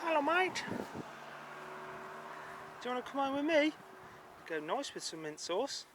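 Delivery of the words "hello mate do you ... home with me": much higher, sing-song animal talk; high baby-talk greeting to a sheep; cooing at a sheep. Acted. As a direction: coax the sheep sweetly.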